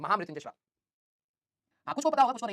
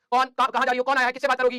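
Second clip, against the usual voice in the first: loud rapid-fire questioning like a suspicious mother; mock interrogating mother, loud; nagging mom; 3 semitones higher, 8 dB louder than usual. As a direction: fire off the questions like a mom grilling her daughter. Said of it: "दस सवाल पूछेंगे".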